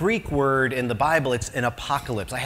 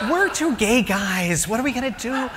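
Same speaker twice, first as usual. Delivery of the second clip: mock worry; 8 semitones higher than usual. Retold own worried reply.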